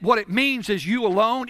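ordinary talk

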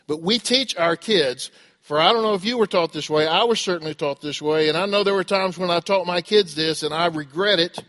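ordinary talk, nothing marked

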